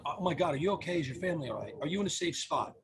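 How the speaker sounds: soft, reassuring concern; empathetic tone. Shown as a demonstration. Lawyer's helpline checking on a hurt caller, empathetic. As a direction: check on them gently and quietly. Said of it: "with my tone, my intonation".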